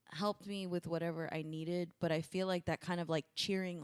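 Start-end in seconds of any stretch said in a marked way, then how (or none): none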